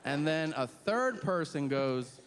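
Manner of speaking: normal talking voice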